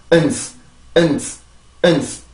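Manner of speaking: "은스" with a small closed schwa, hissed s; schwa demo. Shown as a demonstration. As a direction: say "은스" short, jaw barely open. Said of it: "이 슈아 발음은 턱이 열리지". (턱을 열지 않는 슈아 "은스")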